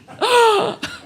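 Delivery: a sharp, high intake of breath; shocked high gasp. Acted. Amelia gasping at the news about the minister. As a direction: gasp in scandalized surprise. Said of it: "Amelia gasped".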